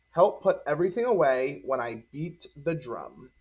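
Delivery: ordinary delivery, nothing marked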